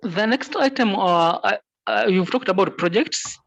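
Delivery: conference call. Real, not acted. Remote member speaking over the call.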